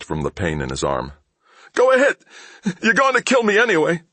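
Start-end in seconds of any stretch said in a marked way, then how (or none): 1.7-4.0 much higher, louder, fast frightened thief voice; terrified thief. A narrator's reading. Thief pinned down by Mal, high and frightened. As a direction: gasp it out like a man in pain.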